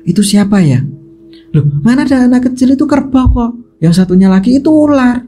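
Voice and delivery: retold squabble, pitch climbing in disbelief; bickering. Young men arguing over what they each saw, bickering. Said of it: "kayak bertengkar gitu loh".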